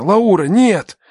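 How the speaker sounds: high, desperate call; alarmed cry. Acted. Man sees her eyes closing, alarmed cry. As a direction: cry out to stop her slipping away. Girl's eyes begin to close.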